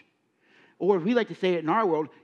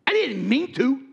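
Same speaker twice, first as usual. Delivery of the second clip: defensive excuse; 9 semitones higher, 5 dB louder than usual. Retold or imitated. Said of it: "I go".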